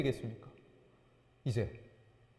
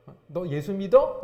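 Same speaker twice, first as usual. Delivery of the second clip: loud, drawn-out mock question; acted challenge; 2 semitones higher, 9 dB louder, slower than usual. Scoffer asking a believer about Jesus, mock challenge.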